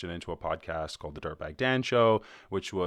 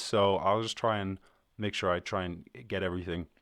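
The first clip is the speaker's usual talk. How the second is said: ordinary talk, nothing marked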